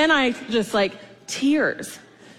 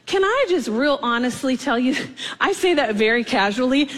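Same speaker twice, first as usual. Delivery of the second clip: ordinary talk, nothing marked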